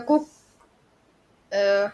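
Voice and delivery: ordinary talk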